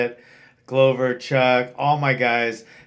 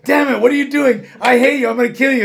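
much higher, louder, fast mock rage; mock furious outburst; 9 semitones higher, 5 dB louder, faster than usual. McGregor freaking out, hammed-up fast rage. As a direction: rant like he's lost it. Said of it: "freak him out and go".

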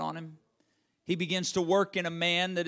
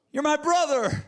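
higher and louder, breaking with anguish; desperate cry; 10 semitones higher, 5 dB louder than usual. Acted. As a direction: push the second plea further, desperate. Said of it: "pleading anguish of his soul".

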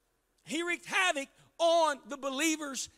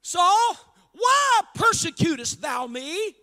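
loud commanding call of the name; calling voice from heaven; 3 semitones higher, 7 dB louder, slower than usual. Acted. Voice from heaven calling down to Saul, loud commanding call. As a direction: boom it down from the sky. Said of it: "a voice out of heaven made this statement".

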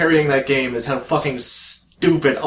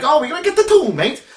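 high, loud mock cockney accent; bad cockney; 11 semitones higher, 3 dB louder than usual. Guessing if the British guy was cockney, bad cockney accent. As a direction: put on an exaggerated cockney whoop. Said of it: "was like a cockney".

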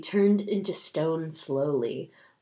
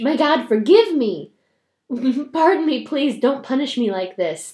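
begging for mercy; 5 semitones higher, 5 dB louder than usual. Acted. Girl begs for mercy.

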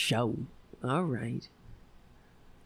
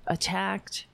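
ordinary delivery

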